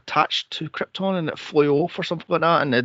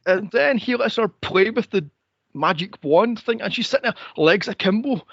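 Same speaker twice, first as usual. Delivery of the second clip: ordinary talk, nothing marked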